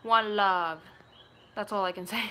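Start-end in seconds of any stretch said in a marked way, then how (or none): 0.0-0.8 louder put-on Jamaican accent; drawn-out mock Jamaican. Shown as a demonstration. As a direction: attempt a Jamaican greeting accent. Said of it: "Can you do a Jamaican accent?".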